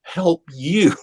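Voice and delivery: a hint of Tom Cruise pleading; quoting the famous movie scene, lightly. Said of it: "where Tom Cruise was looking up at Cuba Gooding".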